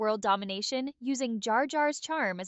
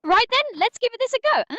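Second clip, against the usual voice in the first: high, perky British voice, much higher pitch; chirpy British opener, pitched up well above normal; British accent; 10 semitones higher, 7 dB louder than usual. Acted. Streamer asked for British Jar Jar, British voice kicks off. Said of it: "all in British [name]'s, you know, voice".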